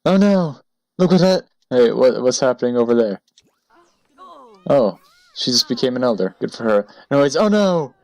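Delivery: over-the-top mock shock; hammed-up panic. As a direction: play a melodramatic gasp at a murder. Hammed panic over a Sim death.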